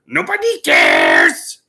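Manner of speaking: slow, loud squawking parrot shout; a croaking bird yelling the words slowly. Retold or imitated. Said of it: "the bird just all of a sudden goes".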